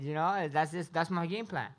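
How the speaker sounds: normal talking voice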